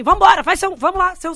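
imitando o diretor mandando, bem mais alto e agudo; ordem impaciente. Retold or imitated. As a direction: apressa a comediante, impaciente. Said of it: "Ele fez, ele começou pra mim". (ordem impaciente e alta)

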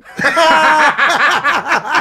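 high, real peal of laughter; bursting laugh. Real, not acted. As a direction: crack up in a high cackle. Laughs at the abbreviation.

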